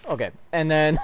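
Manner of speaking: ordinary talk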